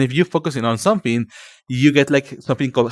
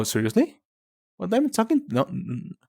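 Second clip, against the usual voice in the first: ordinary talk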